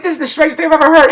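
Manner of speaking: high, loud, fast incredulity; baffled disbelief. Retold or imitated. Guest reacting to a story that makes no sense, incredulous. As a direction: protest in bewildered disbelief. His baffled reply in retold argument.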